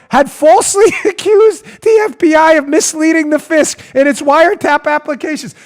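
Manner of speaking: disbelieving quote, laughing through it; laughing in disbelief. Real, not acted. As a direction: laugh as you read the absurd claim.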